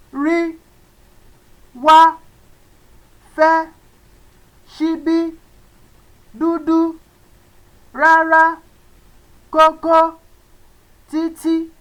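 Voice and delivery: "wá", "fẹ́", "dúdú" said high and level; high tone words. Shown as a demonstration. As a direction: keep every word up on the high tone. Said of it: "Let's go over it again".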